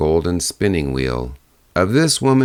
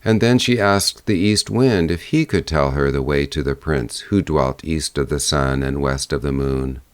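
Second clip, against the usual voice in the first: ordinary delivery, nothing marked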